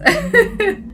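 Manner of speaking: high squealing laugh; burst of laughter. Real, not acted. Reader cracking up at the book, high laugh. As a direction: crack up at the absurd line.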